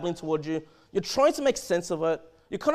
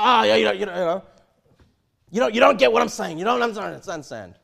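louder, exasperated huff at the listener; frustrated fool; 8 dB louder than usual. Retold or imitated. Fool snapping at someone who asks questions, exasperated. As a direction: act out the irritated babbler brushing you off.

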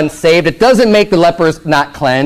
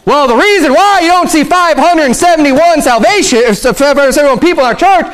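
high, insistent pulpit rebuttal; high-pitched preacher driving the point home; impassioned preaching; 10 semitones higher than usual. Acted. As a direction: fire the answer back in a raised pitch. Preacher answers the scoffer forcefully.

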